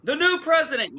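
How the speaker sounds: very high, loud headline cry; headline yell. Acted. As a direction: blare it out like an all-caps headline. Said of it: "That's how the print comes out to me".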